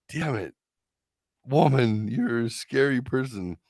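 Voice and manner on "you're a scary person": usual speaking voice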